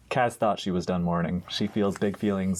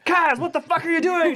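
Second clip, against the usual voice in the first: high, loud scolding shout; crew's angry yell; 5 dB louder than usual. Acted. Crew yelling at Kaz in her stress dream, high angry shout. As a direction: yell it at a bungling shipmate. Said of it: "and everyone's like".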